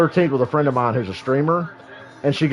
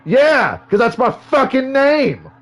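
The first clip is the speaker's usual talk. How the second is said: higher, slow, fed-up retort; mock-exasperated higher drawn-out comeback; retold exasperated retort; 9 semitones higher, 3 dB louder, slower than usual. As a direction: spell it out like he's an idiot. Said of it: "And I'm like".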